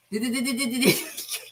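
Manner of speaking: imitating a stuck stutter on D-d-d-d-d-d-d-d. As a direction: repeat the D sound in quick stuck bursts. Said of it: "he stuttered so bad".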